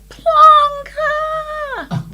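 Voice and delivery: high, drawn-out yell; calling out. Retold or imitated. Friend hailing her from a passing limo, calling out. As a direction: holler it long and loud from afar.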